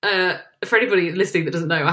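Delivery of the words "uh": exasperated words through laughter, jumping higher; laughing exasperation. Real, not acted. Co-host hearing of vegan salad cream, laughing exasperation. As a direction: groan and laugh in disbelief.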